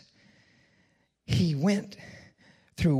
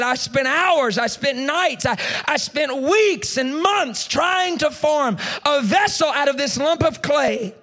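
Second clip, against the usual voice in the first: much higher and louder, dragging out the weeks; frustrated outburst; 11 semitones higher, 7 dB louder, slower than usual. Retold or imitated. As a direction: cry out the long struggle. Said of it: "I got so frustrated".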